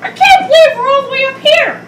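high, fast character voice; Carolyn's voice. Pretend. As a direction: squeal it as the girl toy. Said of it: "Why did you just change to Carolyn's voice?".

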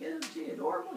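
high-pitched cooing question; put-on high wifely voice; doting mother. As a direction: coo over the baby. Voices mother showing off baby.

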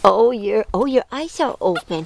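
normal talking voice

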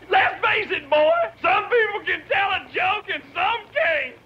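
high-pitched, on the edge of laughter; breaking up. Real, not acted. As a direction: force the punchline out before you crack up. Teller barely gets punchline out laughing.

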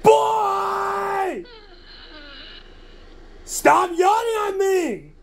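high, slow angry yell; angry shout. Acted. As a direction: drag out the scolding. Scolding the yawning cat.

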